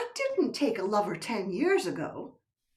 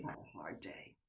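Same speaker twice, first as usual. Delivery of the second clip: whisper; 20 dB quieter than usual. Acted. Wife soothing husband.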